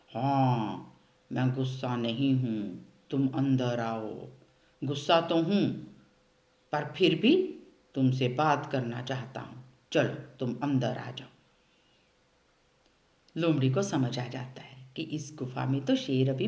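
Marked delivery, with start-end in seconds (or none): 0.1-11.3 lower, deep voice pretending to be the cave; lion posing as cave. A lion inside the cave fakes the cave answering the fox, lower. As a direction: play a dumb lion faking the cave's voice. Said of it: "अंदर से गुफा बनकर जवाब दे दूंगा".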